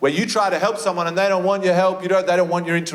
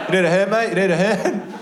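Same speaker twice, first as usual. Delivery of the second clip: eager bloke offering help; 5 semitones higher than usual. Retold or imitated. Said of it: "Three of them will appear straight away".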